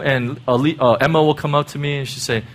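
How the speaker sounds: ordinary talk, nothing marked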